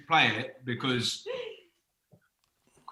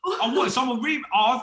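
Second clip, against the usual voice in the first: surprised; 11 semitones higher, 6 dB louder than usual. Real, not acted. Host teases a messy remix.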